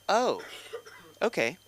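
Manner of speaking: high, quick awkward goodbye; secretary ending an awkward call; awkward receptionist. Retold or imitated. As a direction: hurry off the phone. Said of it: "And she's like".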